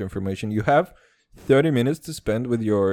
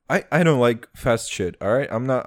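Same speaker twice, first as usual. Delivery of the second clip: ordinary talk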